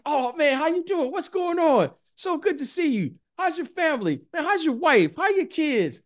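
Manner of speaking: high, bubbly, overjoyed greeting; mock cheerful reunion. Ex-player to officials, greeting them in a high, overjoyed voice. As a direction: pitch it way up like old friends reuniting. Imitating cheerful referees chatting.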